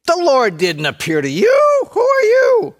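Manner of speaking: high, loud mocking disbelief; scornful challenge. Preacher as doubters challenging Moses, loudly incredulous. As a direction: shoot up to an incredulous high-pitched question.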